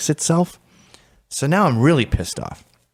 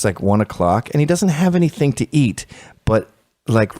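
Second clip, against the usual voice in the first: ordinary talk, nothing marked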